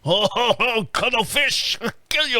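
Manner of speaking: high, slow, hammed-up killer whale; mock orca voice. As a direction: play a menacing orca as a joke. Orcas voiced attacking boats.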